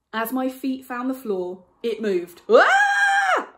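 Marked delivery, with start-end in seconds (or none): 2.5-3.5 a sudden, very high, loud yelp of fright; sharp yelp. Acted. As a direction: yelp as the ground slides away. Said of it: "I yelped".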